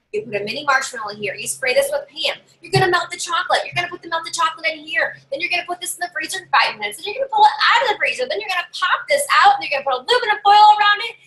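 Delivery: rattled off quick. Shown as a demonstration. Consultant rushing the funnel recipe to the party, fast and laughing. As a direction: race through the steps until you crack up. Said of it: "I'm gonna say this quick".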